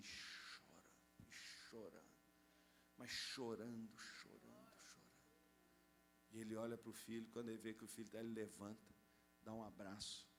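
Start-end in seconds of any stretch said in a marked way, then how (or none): 0.0-5.1 lower, much softer, slow and hushed; hushed emotion. A narrator's reading. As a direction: let the word fade to almost nothing. Father weeps at son's kiss. (sussurrado e emocionado, bem baixo)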